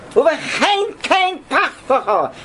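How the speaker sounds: high, slow, drawn-out mock congregational cry; stretched high wail of prayer, acted out; imitated screamed prayer. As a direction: imitate worshippers crying out a prayer. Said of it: "Everybody's screaming".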